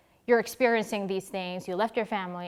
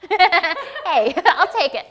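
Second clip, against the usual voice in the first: higher, louder, laughing it off; cheerfully owning the advantage with a laugh; 10 semitones higher, 12 dB louder, much slower than usual. Real, not acted. Laughing about unfair advantage.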